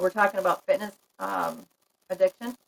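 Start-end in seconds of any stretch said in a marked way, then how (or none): 1.2-2.6 hiccups. Real, not acted. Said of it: "Excuse me, I got the hiccups".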